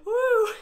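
loud, very high woo; high whoop. Shown as a demonstration. Yoga leader in the middle of laughter exercise, high loud whoop.

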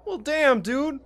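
defensive frustrated yell; exasperated outburst. Acted. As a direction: throw up your hands and snap back. Friend snaps back.

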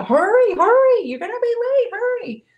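high, loud hurry-up nag, re-enacted; mock nagging. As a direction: imitate a mom calling out urgently to her son. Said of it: "even when I'm nagging him to".

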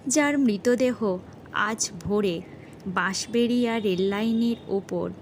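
plain reading voice

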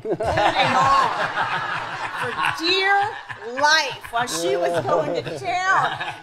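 slow, loud, laughing through it. Real, not acted. Singer telling the bug story, slow laughing delivery. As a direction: stretch it out while cracking up.

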